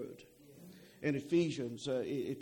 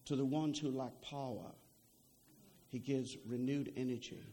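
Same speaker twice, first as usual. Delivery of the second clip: quiet scripture quote; 4 semitones lower, 5 dB quieter than usual. Retold or imitated. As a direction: read the promise gently, almost to yourself. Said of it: "He says".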